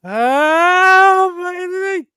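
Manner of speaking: high, loud ghostly wail; seething shrieking spirit. Retold or imitated. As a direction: moan it like a trapped ghost. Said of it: "in the Poltergeist where he's like".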